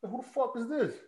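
high-pitched, incredulous challenge; retold indignant demand. Man challenging a stranger on the phone, indignant. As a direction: pitch it up sharply without shouting.

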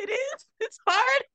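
high, small tearful voice, re-enacted; weepy. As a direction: squeak it out as if welling up. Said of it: "I got a little weepy".

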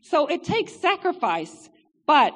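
normal talking voice

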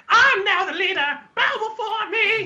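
high, loud gloating villain voice; megalomaniac rant. Retold or imitated. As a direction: crow it like a cartoon usurper. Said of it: "the part of".

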